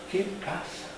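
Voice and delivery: susurra la pregunta, tímido y a ciegas; un ciego que pregunta en voz baja, casi sin voz; pregunta susurrada. Acted. (ciego que no ve, pregunta en un susurro)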